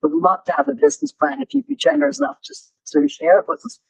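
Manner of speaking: a pushy visitor rushing his request; fast pushy businessman. Retold or imitated. The visiting publisher pressing for a business plan, fast and pushy. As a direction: rattle it off quickly, pressing for a plan. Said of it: "He said".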